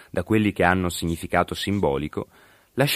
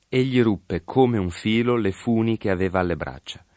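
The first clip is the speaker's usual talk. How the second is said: ordinary delivery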